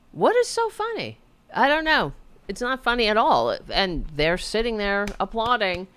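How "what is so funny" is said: incredulous indignation. Real, not acted. Angry at laughing audience.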